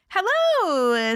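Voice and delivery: ordinary delivery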